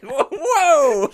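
high, loud comic protest; mock alarm. Pirate taken aback by Hook's outburst, mock alarm. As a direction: recoil in exaggerated shock.

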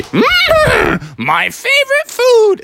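very high, slow greedy donkey; donkey spotting his favorite food. Acted. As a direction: savor it like a hungry cartoon donkey. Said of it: "in donkey voice say".

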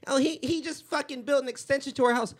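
high-pitched mock bragging woman; gushing women. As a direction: imitate women boasting about a husband. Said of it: "there's women like".

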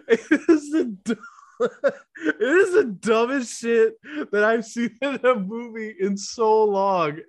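high, fast words through laughter; laughing hard. Real, not acted. Cracking up over the dumbest movie scene, laughing. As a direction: squeeze it out between laughs.

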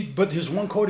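ordinary talk, nothing marked

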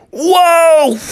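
high, loud startled yelp; startled whoa. Acted. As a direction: jump back in surprise. Ball flies at Bubby.

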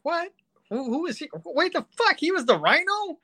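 high-pitched stunned what; shocked, can't believe it; shocked disbelief. Retold or imitated. Shocked at casting reveal.

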